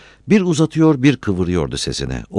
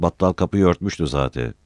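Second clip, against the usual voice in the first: plain reading voice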